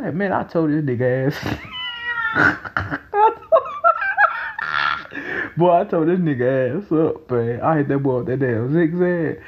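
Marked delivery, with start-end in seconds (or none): 1.4-5.5 long high laugh; burst of laughter. Real, not acted. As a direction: crack up helplessly. Laughing at his friend.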